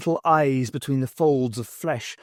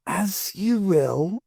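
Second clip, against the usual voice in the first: courteous; 7 semitones higher, much slower than usual. A narrator's reading. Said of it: "he said courteously".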